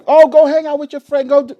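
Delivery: high, loud mock-wife voice; imitated urging wife. Wife telling husband to go out with friends, imitated urging. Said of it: "My wife, my wife used to tell me".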